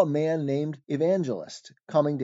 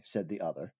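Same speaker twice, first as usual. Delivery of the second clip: usual speaking voice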